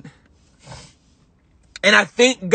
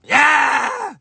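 emotional yell; 7 semitones higher than usual. Real, not acted. Tearful outburst after crying.

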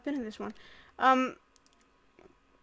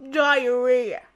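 cartoon dad impression; 8 dB louder than usual. Punchline of the Peter impression.

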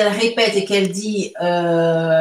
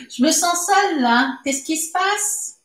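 mock lonely; 8 semitones higher than usual. Teacher mock-lonely, laughing.

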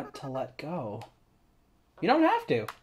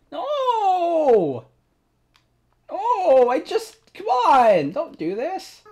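high, loud pleading; desperate cry; 12 semitones higher, 9 dB louder, much slower than usual. Real, not acted. Player begging a departing friend to stay. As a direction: beg them not to leave. Character says goodbye.

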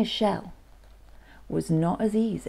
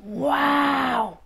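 long, high-pitched wow of wonder; awestruck slug; 8 semitones higher than usual. Acted. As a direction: draw the wow out in awe. Awestruck slug watching the snails.

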